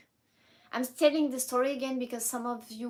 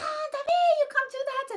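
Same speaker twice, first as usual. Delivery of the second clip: shocked scolding; 9 dB louder than usual. Retold or imitated.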